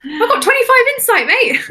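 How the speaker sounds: high, loud crowing brag; triumphant cry of twenty-five insight, mate; excited boast. Real, not acted. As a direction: crow about the high roll. Boasting about her insight roll.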